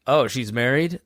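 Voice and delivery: normal talking voice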